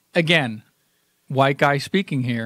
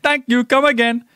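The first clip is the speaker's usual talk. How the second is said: much higher and louder, an exaggerated Indian shopkeeper accent; Indian accent impression; 10 semitones higher, 5 dB louder than usual. Actor surprising the table read with an Indian cashier accent. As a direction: hit it like the famous cashier line. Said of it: "he went".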